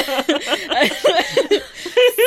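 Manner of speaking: high, helpless laughing fit; laughing hard at her own embarrassment; loud burst of laughter. Real, not acted. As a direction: crack up at yourself.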